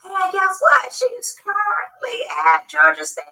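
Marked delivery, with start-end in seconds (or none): none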